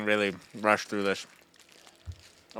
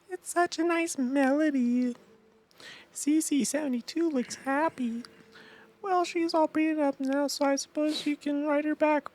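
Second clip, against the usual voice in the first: put-on girl voice. Game girl returning the horse, high falsetto girl voice. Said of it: "My girl voice is the exact same".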